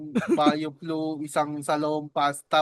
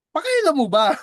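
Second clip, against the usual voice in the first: mock retort; 8 semitones higher, 5 dB louder than usual.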